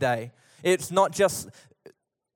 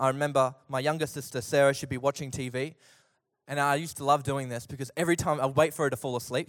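normal talking voice

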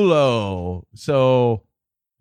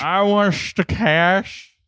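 put-on drunk uncle voice; 6 semitones higher, much slower than usual. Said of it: "the drunk uncle".

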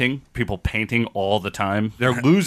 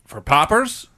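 Chicago accent; 5 semitones higher, slower than usual. Real, not acted. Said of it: "excuse my Chicago accent".

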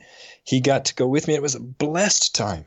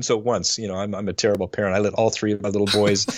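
ordinary talk, nothing marked

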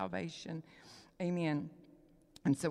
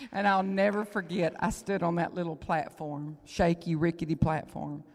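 preaching at full force; 8 dB louder than usual. Acted. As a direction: proclaim it to the whole room.

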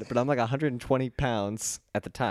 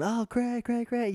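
high-pitched excited fan calls; fawning crowd; 13 semitones higher, slower than usual. Retold or imitated. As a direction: imitate fans calling out in a high voice. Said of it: "everybody's going".

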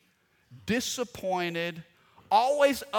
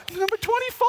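much higher, proud squeal of a mom; 5 dB louder, faster than usual. Retold or imitated. Mom seeing the newspaper photo, high proud squeal. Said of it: "Mama's like".

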